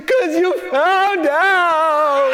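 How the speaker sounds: high, very drawn-out mock sob; hammed-up tearful whine; wailing child. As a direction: imitate a scared, crying little boy. Crying son admits real reason.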